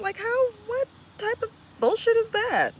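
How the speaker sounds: high, loud incredulous burst; incredulous outrage. Real, not acted. Streamer ranting about weird people online, incredulous. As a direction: voice leaps up in disbelief.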